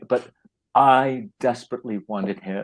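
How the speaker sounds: normal talking voice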